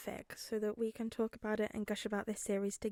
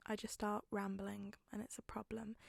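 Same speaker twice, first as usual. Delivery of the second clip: softer embarrassed whisper; 9 dB quieter than usual. Real, not acted. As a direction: confess it under your breath. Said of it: "self-deprecating whisper".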